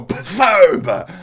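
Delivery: loud high explosive pfoob; nuke blast noise. Retold or imitated. Zerg stepping on a nuke, shouted explosion noise. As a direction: spit out a big explosion sound.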